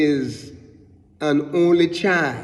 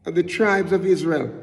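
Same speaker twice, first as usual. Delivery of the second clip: rising preaching fervour; 5 semitones higher than usual. Real, not acted. Preaching rises in pitch.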